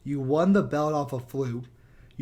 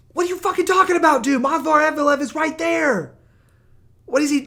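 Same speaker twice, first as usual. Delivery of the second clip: much higher, loud outraged yelling; exasperated shout; 13 semitones higher, 10 dB louder than usual. Real, not acted. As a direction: blow up at the absurd take. Ranting at bad take.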